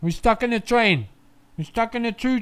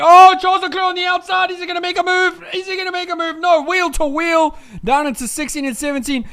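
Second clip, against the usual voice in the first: excited commentary; 5 semitones higher, 5 dB louder than usual. Real, not acted. Live overtake battle.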